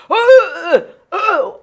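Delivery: high, loud strangled cries; imitated dog choking. Owner mimics her dog's loud strangled cries at the vet. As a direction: let out the dog's choked wails. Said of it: "my dog is like".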